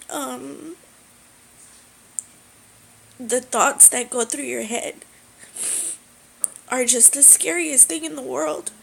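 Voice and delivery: fighting back tears while telling it; crying. Real, not acted. Telling viewers about months of suffering, crying. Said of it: "I don't mean to cry".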